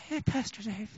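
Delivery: tiny, very soft timid greeting; mousy. Retold or imitated. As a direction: imitate a mousy woman barely audible. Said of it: "this sister was a little mousy".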